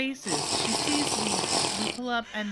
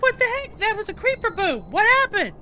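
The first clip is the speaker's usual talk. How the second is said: much higher, louder startled questions; shocked outburst; 8 dB louder than usual. Retold or imitated. Player asking what blew up, alarmed and loud. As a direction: blurt it out in alarm. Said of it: "I'm like".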